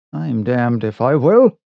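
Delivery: ordinary delivery, nothing marked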